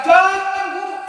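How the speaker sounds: pleading disciples begging him to stay; disciples forbidding. Retold or imitated.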